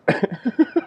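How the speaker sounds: high giggle at his own joke; laughing. Real, not acted. Speaker after the yoga pants joke, laughing. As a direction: break into a high chuckle.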